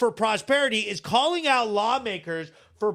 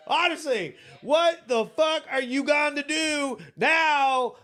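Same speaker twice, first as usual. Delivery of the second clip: taunting shout; 5 semitones higher than usual. Real, not acted. Taunting Garcia.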